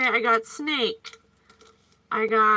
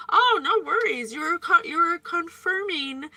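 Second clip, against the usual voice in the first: ordinary talk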